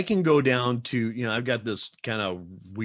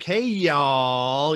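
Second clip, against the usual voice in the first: higher, stretched Southern greeting; Southern drawl; 4 semitones higher, 6 dB louder than usual. Acted. Back from a day in Texas, hammed-up Southern drawl. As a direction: draw out "y'all" like a Texan. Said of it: "come back with a Southern drawl".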